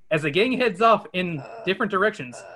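ordinary talk

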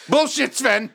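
high, put-on exclamation; mock outraged retort. Heckler calling out Sven's fake accent, high mock outrage.

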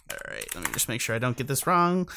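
normal talking voice

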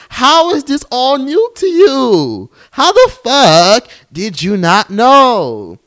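high, stretched-out incredulous wail; Amanda Seales. Retold or imitated. Podcaster asking how this is news, exasperated impression. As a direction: draw every word out high and exasperated. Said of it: "In my damn Amanda Seales voice".

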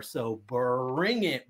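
ordinary talk

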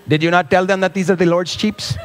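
put-on light Pakistani accent, a question; hint of a Pakistani accent. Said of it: "a, a Pakistani accent there".

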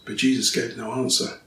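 ordinary delivery

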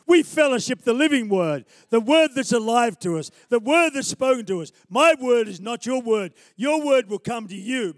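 very loud, driving pulpit proclamation; full-force preaching. Real, not acted. Preacher urging the congregation to fellowship the word, full-force preaching.

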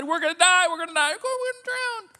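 high, loud panicked wail; frightened disciples crying out in the storm. Retold or imitated. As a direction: wail it as if the boat is going under. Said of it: "to the other side".

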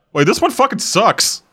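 high, exaggerated whiny voice; mock complaint. Ghost dude complaining, high whiny mock voice. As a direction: pitch it up and whine. Acted ghost line in a story.

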